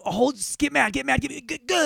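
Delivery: high, strained, stuttering build-up to a grunt; hammed-up frantic babble that breaks into stutters; mock climax. Man on the phone goading her, mock climax. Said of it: "Then you fucking go".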